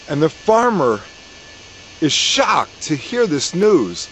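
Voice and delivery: ordinary delivery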